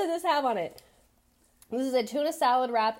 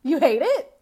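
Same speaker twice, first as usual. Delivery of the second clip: louder and higher, cracking up mid-question; laughing mock parent; 5 semitones higher, 7 dB louder than usual. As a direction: lose it laughing on the last guilt-trip line. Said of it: "you're gonna be like".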